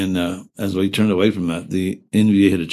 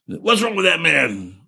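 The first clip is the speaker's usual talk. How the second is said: annoyed officer; 9 semitones higher, slower than usual. Retold or imitated. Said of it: "they got pissed off".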